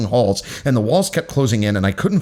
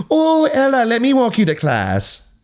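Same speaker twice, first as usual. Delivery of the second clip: much higher, louder, fussy teacher voice; flustered teacher; 4 dB louder than usual. Acted. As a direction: gush nervously to cover being caught. Flustered teacher caught spying.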